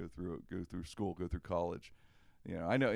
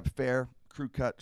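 ordinary delivery

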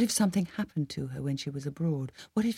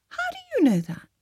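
much higher, sharp startled question. A narrator's reading. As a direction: jump up in pitch, caught off guard. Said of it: "very startled".

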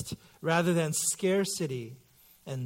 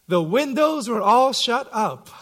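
put-on innocent little girl reading; 6 semitones higher, 7 dB louder than usual. Daughter reads the sentence innocently.

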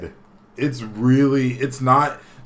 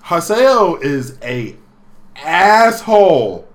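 each word drawn out slowly, asshole pushed louder; slow emphatic; 5 semitones higher, 8 dB louder, much slower than usual. Real, not acted. Telling his cohost about Haseo, drawn-out emphasis. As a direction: lean hard into asshole, slowly. Ranting about a rude character.

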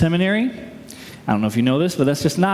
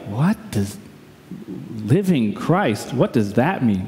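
low, halting, baffled aside; retold confused thought, pitched down; private puzzlement; 6 semitones lower than usual. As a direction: puzzle it out under your breath. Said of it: "And I was like".

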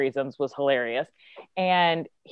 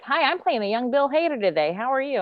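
put-on higher, bright child voice; little boy; 8 semitones higher than usual. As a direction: chirp it like a polite five-year-old. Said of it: "this little five-year-old boy was like".

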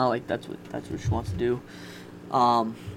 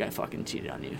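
low whispered confession; whispered confession, much lower; hushed confession; 11 semitones lower, 11 dB quieter than usual. Retold or imitated. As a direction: breathe the admission out, barely voiced. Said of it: "I'm like".

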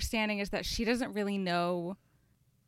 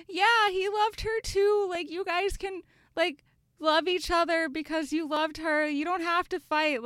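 gushing YouTuber impression; 9 semitones higher, 3 dB louder than usual. Teary YouTuber urging the sisters to stop fighting, in a high cooing voice. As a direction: coo it like a teary YouTuber begging them to make up. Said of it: "and they were like".

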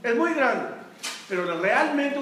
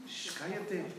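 soft whispered shh, cállate; quiet him down without raising your voice; whispered hush; 4 semitones lower, 15 dB quieter than usual. Retold or imitated. Man listening for a fallen chick, whispering to his friend. Friend hushes companion again.

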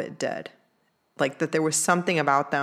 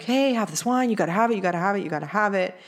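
pushy relatives; 3 semitones higher, faster than usual. Retold or imitated. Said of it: "would pressure me".

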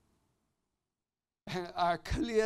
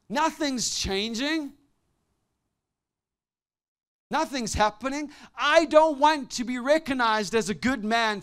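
desperate cry to God; 7 semitones higher, 7 dB louder than usual. Retold or imitated. Preacher retelling his burnt-out prayer, raised pleading cry.

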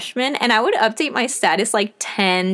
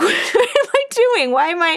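laughing disbelief; 9 semitones higher than usual. Retold or imitated. Retold waking up confused.

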